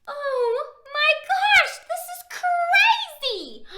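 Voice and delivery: high, slow, loud and laughing; excited. Acted. Reader marvelling to children, laughing excitement. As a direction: gush with laughing wonder.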